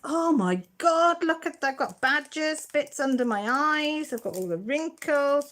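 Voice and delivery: high, loud mock horror at the photo; shocked at own photo. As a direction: act appalled at your own wrinkles. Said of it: "And I thought".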